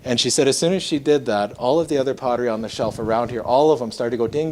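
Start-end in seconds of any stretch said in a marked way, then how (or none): none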